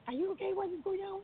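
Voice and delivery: high, hushed panicked questions. Retold or imitated. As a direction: retell it in a small, alarmed high voice. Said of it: "I panic".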